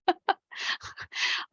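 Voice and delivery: high, breathy shy giggle; giggle, embarrassed at yawning; unvoiced nervous giggle. Real, not acted. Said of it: "I keep wanting to giggle".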